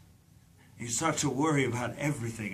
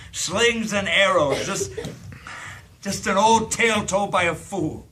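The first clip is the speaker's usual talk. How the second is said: bitter declaiming; 5 semitones higher, 5 dB louder than usual. Acted. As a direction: declaim it like a mocking tragedian. Drunk mocking life's meaning.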